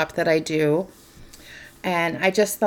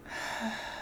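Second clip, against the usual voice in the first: long, very soft voiceless sigh; empty the breath right out, softly; emptying exhale; 18 dB quieter than usual. Shown as a demonstration. Guide emptying the breath with listeners, soft exhale. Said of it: "Empty everything out".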